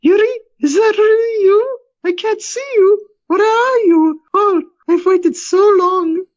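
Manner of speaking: very high, tearful falsetto; longing high wife's voice; creature speaking as wife. Acted. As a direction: plead to your husband in a high, crying voice. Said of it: "The Alina thing speaks".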